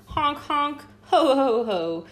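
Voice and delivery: high, loud honking laugh; honking gander laugh. A narrator's reading. Mocking the hedgehog, a loud honking gander laugh. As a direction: honk and laugh like a goose. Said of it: "the gander laughed".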